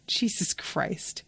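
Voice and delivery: ordinary talk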